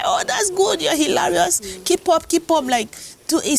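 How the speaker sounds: high-pitched gushing fans; enthusiastic praise. Retold or imitated. Followers praise her posted skit, high and enthusiastic.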